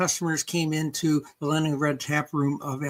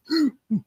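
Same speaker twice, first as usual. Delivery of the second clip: quick high gasp of surprise; sharp gasp; 11 semitones higher than usual. Real, not acted. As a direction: suck in air, startled. Sudden reaction mid-story.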